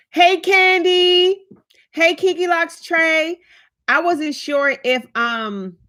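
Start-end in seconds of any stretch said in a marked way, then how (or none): 0.1-3.4 sing-song greeting. Real, not acted.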